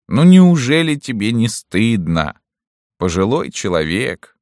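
loud, high reproach; shaming. A narrator's reading. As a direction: scold him, aren't you ashamed. Colonel shaming the prisoner.

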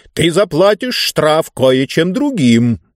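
imperious ruler setting his price; pompous ruler. Acted. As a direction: relish the hint, drawing it out.

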